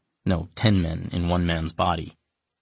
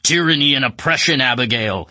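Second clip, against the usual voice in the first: roared much higher and louder; monster roar; 7 semitones higher, 7 dB louder than usual. Acted. Wajim freaking out at the narrator, roaring. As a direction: bellow it like a furious chained beast. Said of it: "roaring and yanking on his chain".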